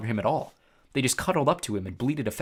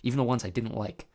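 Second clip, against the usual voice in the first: plain reading voice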